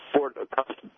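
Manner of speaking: garbled phone audio cutting out; words lost on a bad connection; breaking up. Real, not acted. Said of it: "you broke up again".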